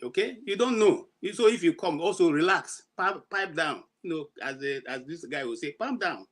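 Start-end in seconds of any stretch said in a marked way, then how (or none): none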